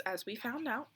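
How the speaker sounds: plain reading voice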